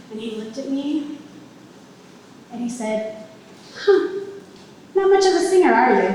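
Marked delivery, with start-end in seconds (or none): 3.8-6.1 higher, louder teasing jab; tossed-off mocking put-down; flippant and nonchalant. Alter ego teasing her about her singing, flippant. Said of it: "he was just like that, just nonchalant, flippant".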